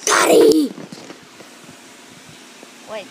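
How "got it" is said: loud triumphant shout. Real, not acted.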